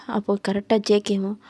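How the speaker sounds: usual speaking voice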